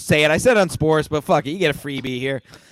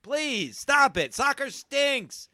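ranting shout; 8 semitones higher than usual. Real, not acted. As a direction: rail at the sport, voice cracking high. Rant against soccer.

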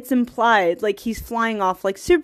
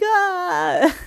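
mock wail; 8 semitones higher than usual. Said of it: "and I was like".

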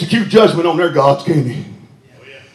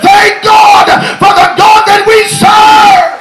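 chanted preaching cadence building to shouted, high-pitched peaks; full-force preaching; 6 semitones higher than usual. Real, not acted. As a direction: preach it in driving bursts, climbing to a shout.

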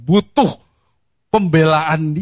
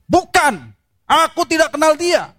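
much higher, insistent denial; Peter's frantic denial; 12 semitones higher than usual. Acted. Preacher voicing Peter denying Jesus to the servant girl, high and insistent. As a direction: deny it fast and high, cornered.

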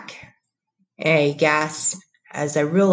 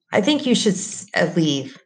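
ordinary delivery

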